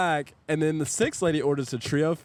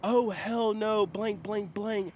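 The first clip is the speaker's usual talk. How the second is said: high, drawn-out mock indignation; indignant retort; 9 semitones higher, much slower than usual. Customer answers the server's warning in a quarrel, mock indignant. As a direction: imitate an outraged customer, pitched up.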